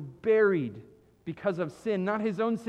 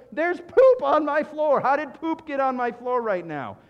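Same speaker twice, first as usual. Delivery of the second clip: loud, high alarmed outburst at poop on the floor; freaking out; 6 semitones higher, 7 dB louder than usual. Pretend. Preacher mimics a homeowner finding poop, freaking out loudly. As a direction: panic loudly, pitch shooting up.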